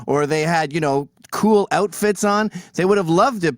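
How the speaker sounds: normal talking voice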